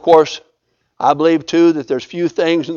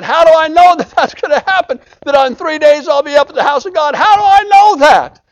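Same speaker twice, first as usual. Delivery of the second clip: much higher, louder anxious king; pleading Hezekiah; 9 semitones higher, 5 dB louder than usual. Retold or imitated. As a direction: beg for a sign. Hezekiah doubting, retold.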